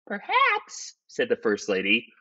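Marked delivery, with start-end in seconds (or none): 0.1-1.0 very high falsetto; high-pitched, tentative wife; suggesting wife. Acted. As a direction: suggest it in a piping wife's voice.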